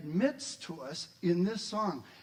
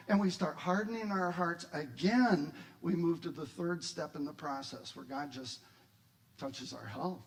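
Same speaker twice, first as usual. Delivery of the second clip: ordinary talk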